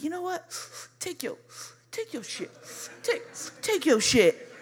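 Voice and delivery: mimed puffs between soft, high words; talking through smoke. Retold or imitated. Handing blunt back mid-puff.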